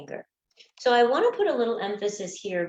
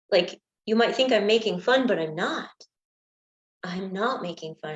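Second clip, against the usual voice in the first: ordinary talk, nothing marked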